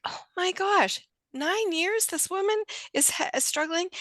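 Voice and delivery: high-pitched incredulous dismay; shocked realization. Retold or imitated. Trainer realizing nurse struggled nine years, shocked. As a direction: exclaim high in pained disbelief.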